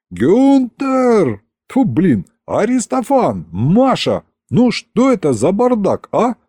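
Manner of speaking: high-pitched yell at moderate volume; mock yell. A narrator's reading. Fallen tsar summons his helpers over the mess, yelling in exasperation. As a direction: call out names in exasperation, pitch jumping up. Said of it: "и заорал".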